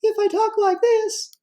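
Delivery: very high squeaky demo voice; tiny, sky-high monotone voice. Shown as a demonstration. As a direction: shoot way up into a falsetto. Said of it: "we recognize that as a higher pitch".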